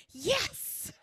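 high, loud triumphant cheer; gleeful gloat. Retold or imitated. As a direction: crow with relief. Gloating relief, retold to other lady.

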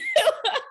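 very high squeal of a laugh; high giggle. Real, not acted. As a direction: burst into a giggle. Laughing at herself.

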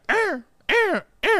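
high wailing siren sound; siren imitation. Retelling the tornado to a friend, a high siren imitation. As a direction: mimic the dorm alarm wailing. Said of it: "all I hear in the background is".

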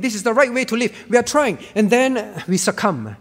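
normal talking voice

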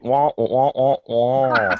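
muffled trombone wah-wah; Peanuts adult voice. Retold or imitated. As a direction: imitate the Peanuts teacher droning. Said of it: "talking like a Peanuts character".

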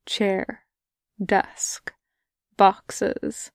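usual speaking voice

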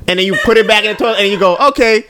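high, laughing mock of his wife rolling paper. Teasing his wife about her toilet paper, high and laughing. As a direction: ham it up high, giggling through the wife's routine. Acting out wife's toilet habits.

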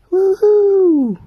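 big high whooping shout; mock cheer. Batman cheering Superman back to life, a loud high whoop. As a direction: throw it up like a giddy fan.

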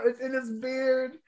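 ordinary talk, nothing marked

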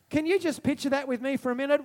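ordinary delivery

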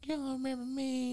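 high, soft put-on girl voice; little soft voice. Caller teasing Mike on the phone, high soft imitation. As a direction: mimic her coy question again in the same voice. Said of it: "She talking with this little soft voice".

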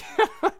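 short high burst of laughter. Real, not acted. Laughs after cursing.

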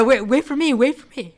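high, loud whining plea; whiny plea. Retold or imitated. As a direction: beg like a clingy kid. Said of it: "and my response was".

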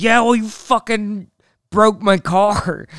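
high, indignant retort, dragged out at the end; mock angry comeback. Dad snapping back at mom over the car, mock angry.

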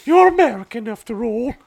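high, put-on regal disdain; the Queen. The Queen snubbing an American, high haughty mock-royal voice. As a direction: look down on them in falsetto. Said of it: "I'm being the queen".

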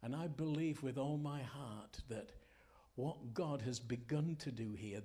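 ordinary talk

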